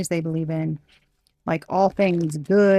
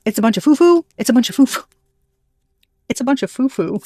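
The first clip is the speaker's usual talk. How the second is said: higher, louder scoffing put-on voice; mocking sceptic; 5 semitones higher, 5 dB louder, faster than usual. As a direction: sneer like someone who thinks it's all nonsense. Voicing a sceptic who doubts clairsentience.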